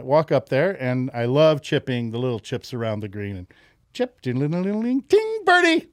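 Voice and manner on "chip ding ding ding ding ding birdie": rising ding-ding to a high, loud birdie; imitated dinging chip-in. Golfer replaying his chip-in, gleeful rising sound effects. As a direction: sing out the dings, climbing to a triumphant peak.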